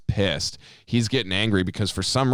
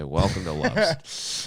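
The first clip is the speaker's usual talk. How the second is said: slow, drawn-out company welcome; slow scripted greeting; much slower than usual. Shown as a demonstration. Clerk greeting a dying customer, slow and scripted. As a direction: recite the store greeting slowly. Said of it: "'cause I gotta say".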